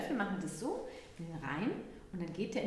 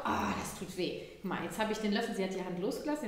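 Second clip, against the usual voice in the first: usual speaking voice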